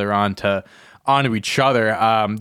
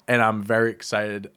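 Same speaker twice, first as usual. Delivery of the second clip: ordinary talk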